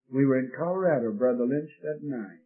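normal talking voice

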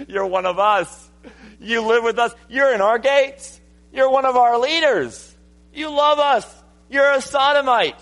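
building to high, loud mock incredulity; acted scornful disbelief. Sons-in-law scoffing at Lot, building mock disbelief.

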